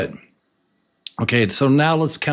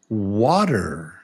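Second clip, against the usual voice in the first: normal talking voice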